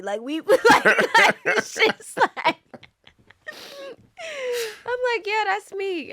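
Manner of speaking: high, loud laughing voice. Real, not acted. Actress cracking up telling the interviewer about a meme. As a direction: laugh your way through it.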